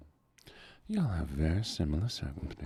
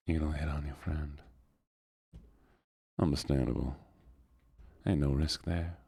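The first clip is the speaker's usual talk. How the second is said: put-on Southern accent. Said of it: "get out of the southern voice".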